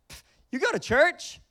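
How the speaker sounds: high, loud mocking scoff; scoffing teen. Teen scoffing at a churchgoing friend, loud mock sneer. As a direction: sneer it like a teen who thinks church is lame.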